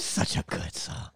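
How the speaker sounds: breathy aside whisper. Real, not acted. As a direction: whisper it to yourself. Whispering after the chant.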